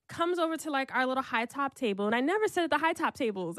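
ordinary delivery

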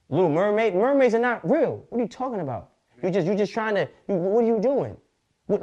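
high, loud mock outrage; indignant rant. Ranting at the Little Mermaid's makers, loud mock outrage. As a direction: scold them like a fed-up preacher.

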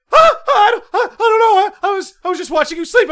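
high, fast panicky stammer; high nervous roommate stammering an excuse; flustered roommate. Retold or imitated. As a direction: caught out, laughing nervously.

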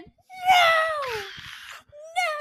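very high, drawn-out screams; screamed "no". Retold or imitated. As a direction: scream it out in mock horror. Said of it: "I screamed bloody murder".

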